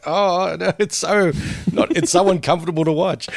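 high cringing groan with a laugh. Retold or imitated. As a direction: squirm through it, laughing. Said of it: "it's like".

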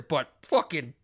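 ordinary talk

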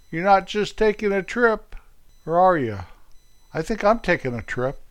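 ordinary talk

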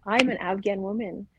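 normal talking voice

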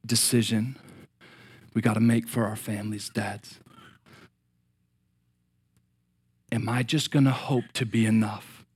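quiet heartfelt appeal; hushed intimate preaching. Acted. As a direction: draw them in quietly. Quiet intimate altar call.

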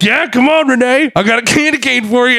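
high, hammed-up drunken come-on; heavy flirting. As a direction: goad her like a leering Santa. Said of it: "immediately starts heavy flirting with Renee".

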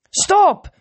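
high, loud cry of stop; shouted command. A narrator's reading. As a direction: leap in with a yell. Annabeth bursts in, shouting.

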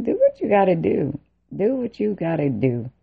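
retold defiant challenge; defiant dare. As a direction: throw out a fed-up dare. Retold dare to military police.

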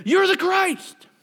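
high, loud, drawn-out proclamation; exclaimed declaration. Acted. Peter answers Jesus before the disciples, exclaimed declaration. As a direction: cry it out as an eager disciple. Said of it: "He said".

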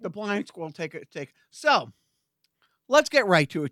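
normal talking voice

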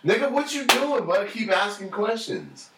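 high, indignant mock protest; mock indignation. Teasing a friend for asking questions, high mock protest. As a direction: squawk it up high in fake offense.

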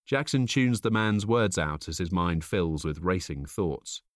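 usual speaking voice

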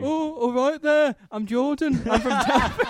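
high, slow mock Telford accent; a teasing Telford accent for a friend; Jordan's Telford accent. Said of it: "How do you talk, Jordan?".

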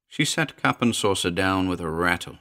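plain reading voice

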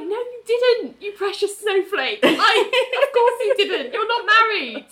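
high, slow, laughing condescension; mock condescending coo. Speaker cooing at Ali about her kiss, high and mocking. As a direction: coo it at a silly child.

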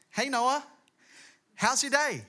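mock wifely morning call; imitating a wife. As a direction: play the chirpy wife checking in. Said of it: "Have you ever noticed how wives do that?".

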